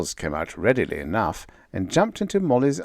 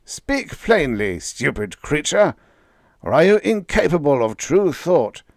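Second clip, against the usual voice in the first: loud, higher, contemptuous and imperious; scornful cosmic voice; 5 semitones higher, 5 dB louder than usual. A narrator's reading. Cosmic Voice scorning Bill's thinking, loud. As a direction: sneer down at a stupid creature.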